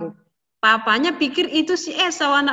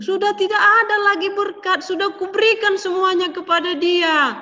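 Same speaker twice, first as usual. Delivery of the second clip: high, loud helpless reply; grieved old father; 9 semitones higher, 4 dB louder than usual. A narrator's reading. Father telling Esau there is no blessing left, high and grieved. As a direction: tell him sadly it is all gone.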